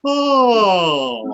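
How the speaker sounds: high, loud yawned oh sliding down; big yawn glide. Shown as a demonstration. Teacher showing a big loud yawn glide. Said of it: "bien grande".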